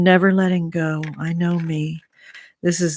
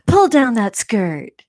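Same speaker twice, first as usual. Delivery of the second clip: mock yell, higher and a bit louder; retold yell; 6 semitones higher, 4 dB louder than usual. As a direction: scold the cheerleader in fun. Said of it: "would yell at her".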